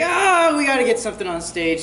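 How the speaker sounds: sudden high yelp then rushed words; panicked rush. Retold or imitated. Teacher mimicking a last-minute panic, blurting fast.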